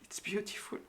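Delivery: whispered, voice catching; emotional whisper. Real, not acted. Tarot reader overcome by the cards, whispering. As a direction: barely breathe the words, moved. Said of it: "I am truly emotional".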